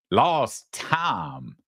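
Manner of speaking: "time" stretched into Southern "tahm"; mock Southern accent. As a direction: mimic her Southern drawl on "time". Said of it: "'Cause that's South Montreal".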